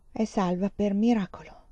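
plain reading voice

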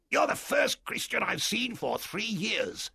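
a wild marooned sailor, glad to see someone; castaway Ben Gunn. Acted.

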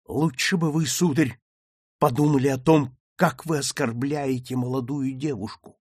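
ordinary delivery

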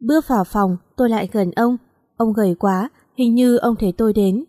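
plain reading voice

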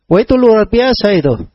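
ordinary delivery

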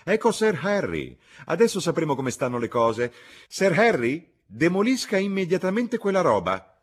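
loud, high-pitched calling out; imperious woman hailing and ordering; shouting. A narrator's reading. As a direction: cry it out at full voice. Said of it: "gridò la signora Honeychurch".

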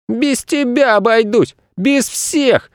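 put-on high-pitched defiant child; boy shouting. A narrator's reading. Boy jumping off his planet, shouting defiantly. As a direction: squeal defiance in a boy's voice. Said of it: "крикнул Федя".

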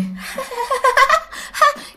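high-pitched jeering cackle, imitated; mocking laugh. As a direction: laugh like someone taunting a fallen rider. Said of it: "hai người á là đang đứng cười". (tiếng cười ghẹo, mỉa mai)

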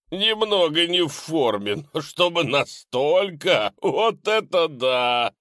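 high, loud mocking ghoul; snarling with hyena giggles. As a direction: jeer at the fat hero.

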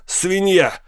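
high-pitched angry roar. A narrator's reading. Man insulting the professor before a crowd, roaring. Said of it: "проревел он".

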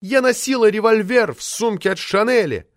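high, impassioned declaiming; fervent high-voiced outburst; carried away. A narrator's reading. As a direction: get worked up and orate at high pitch. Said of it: "разошлась Метелина".

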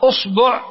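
أصبع said usbu', u in both syllables; short u twice in أصبع; damma, then u. Shown as a demonstration. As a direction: demonstrate أصبع as US-BU'. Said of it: "ثم تأتي إلى الضمة فتقول".

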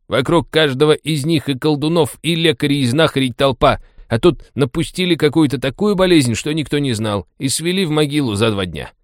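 high-pitched, indignant cabbie; indignant explanation. A narrator's reading. Taxi driver explains the strange death to Masha, indignant. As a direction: argue it out, affronted at the question. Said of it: "возмутился тот".

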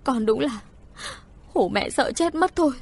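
high-rising tearful scolding from relief; acted maternal wail after a scare; crying mother. A narrator's reading. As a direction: let the fright spill out tearfully. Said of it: "khóc òa thành tiếng".

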